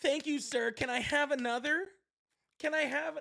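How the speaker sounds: high, simpering thank-you of a yes-man; mock groveling lackey. Mocking groveling congressmen.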